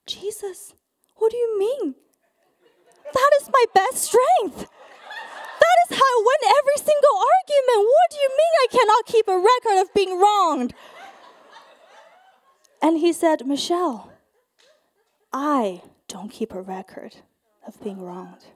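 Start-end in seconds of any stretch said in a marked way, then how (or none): 0.1-10.7 high, loud, hammed-up disbelief; outraged protest. Acted outraged protest to Jesus. 13.4-18.4 patient. Retold or imitated. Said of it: "bless him, being very patient".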